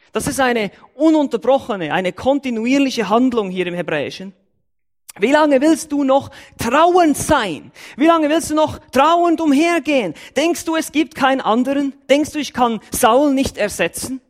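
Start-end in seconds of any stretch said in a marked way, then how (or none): 5.2-14.2 voicing God. God rebuking Samuel for mourning Saul, raised pressing voice.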